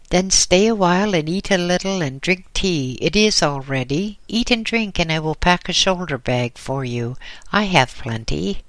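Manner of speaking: lightly voiced old caretaker, bustling and generous. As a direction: fuss kindly over the hungry traveller. Old caretaker urges him eat.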